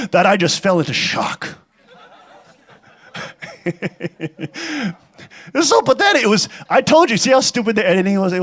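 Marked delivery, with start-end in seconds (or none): 3.1-4.9 soft breathy laugh. Real, not acted. Mocking the interview editing.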